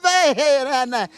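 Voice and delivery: high, bright gushing thanks; excited. Acted. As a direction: gush it out playfully. Host gushing after vendor thanks.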